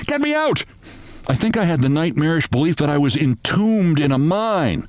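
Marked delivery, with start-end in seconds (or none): 0.1-0.7 high bawling cry for help; bawled. A narrator's reading. Narrator trapped under his bed, high bawl. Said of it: "I bawled".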